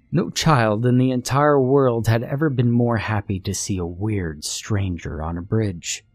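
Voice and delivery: ordinary delivery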